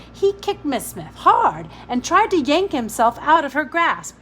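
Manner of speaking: plain reading voice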